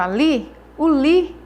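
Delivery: emphatic teacher demo, loud on "li"; stress on li. Shown as a demonstration. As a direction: hammer the "li" in "ali, o li". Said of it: "a que eu pronuncio com mais força".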